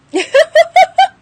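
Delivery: a sudden loud high laugh; high burst of laughter. Real, not acted. Laughing at a client who never applied for the job.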